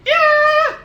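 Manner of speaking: very high, loud mock shriek; imitated crowd cheer. Fans answering a singer on stage, mock screaming cheer. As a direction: squeal like a whole audience.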